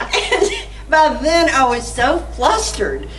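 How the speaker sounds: laughing. Real, not acted. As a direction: still laughing at the memory. Laughing while retelling phone call.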